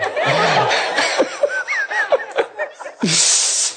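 rolling, gleeful laughter; long high laugh. Real, not acted. Telling the audience the story, long gleeful laughter. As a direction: laugh until it trails off.

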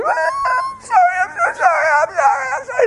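wailing sorry, very high; tearful, high wail; crying apology. Retold or imitated. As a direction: break down crying while apologising. Wife grabs him, crying.

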